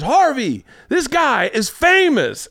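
very high, drawn-out mock yell across a bar; imitated yelling. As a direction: slowly holler it high, imitating a boastful announcement. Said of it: "he was yelling at Harvey Keitel".

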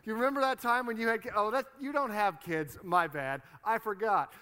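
imitating a jeering, gloating rival; taunting rival. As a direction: needle them loudly with fake innocence. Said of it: "And then Peninnah's like".

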